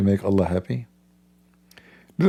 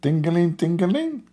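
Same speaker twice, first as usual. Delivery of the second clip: higher sing-song "Tingling, tingling"; mock woman gushing; 7 semitones higher than usual. Speaker imitates a woman at a miracle. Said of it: "You remember this woman?".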